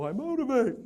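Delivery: hammy motivational boast; mock motivator. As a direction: sell it like a pompous guru. Mocking grand motivator line.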